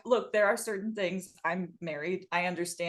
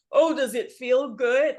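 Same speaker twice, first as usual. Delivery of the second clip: slow, louder, leering mock of a creepy man; 4 semitones higher, 6 dB louder than usual. Host mocking a creepy man's question, slow and leering. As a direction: sneer it like a sleazy guy asking. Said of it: "any man who's like".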